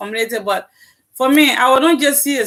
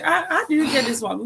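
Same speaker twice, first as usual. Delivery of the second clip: higher, with a light laugh; cheerful compliment with laughter; laughing friendly question; 6 semitones higher than usual. Retold or imitated. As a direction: ask it admiringly through a laugh. Acting friendly compliment to a sister.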